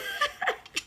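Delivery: airy squeaky giggle; high breathy laugh. Real, not acted. Laughing at own mishap to viewers, high breathy giggle.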